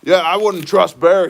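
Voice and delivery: mimicking a dismissive online critic; mocking commenter. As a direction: sneer it like a know-it-all commenter. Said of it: "some guy in there was like".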